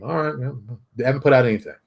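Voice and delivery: ordinary talk, nothing marked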